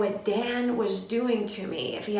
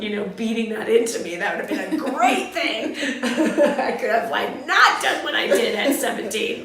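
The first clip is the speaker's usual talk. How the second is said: words breaking into real laughter, louder; laughing through words; 6 dB louder than usual. Real, not acted. Laughing at what might have been.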